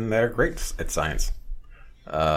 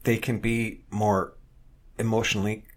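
halting, higher-pitched retold answer with gaps; imitating hard thinking; 4 semitones higher, slower than usual. Stumped woman finally names something about men, haltingly. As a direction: mimic someone searching slowly for each word. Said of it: "she finally thinks real hard about what".

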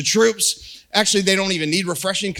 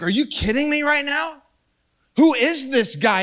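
higher-pitched, indignant disbelief; righteous anger; 6 semitones higher than usual. Retold or imitated. David hears the giant mocking his God, righteously angry. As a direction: act out David's righteous outrage. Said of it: "David gets righteously angry".

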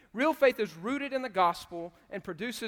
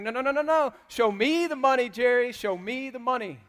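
higher, loud, insistent "no, no"; excited shouting; 5 semitones higher, 8 dB louder than usual. Retold or imitated. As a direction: shout at the agent to say it properly. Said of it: "He's like".

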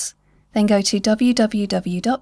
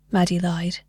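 ordinary delivery, nothing marked

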